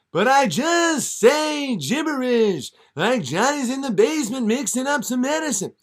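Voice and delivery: high, loud yelling Dylan; hammed-up high Dylan shout; whiny [name]. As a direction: shout it like an eager young Dylan. Dylan protests.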